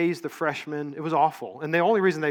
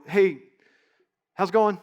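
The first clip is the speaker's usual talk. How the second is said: casual passing greeting; 4 semitones higher, 5 dB louder than usual. Retold or imitated. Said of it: "people walk and they go".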